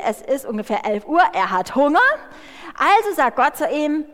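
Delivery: ordinary talk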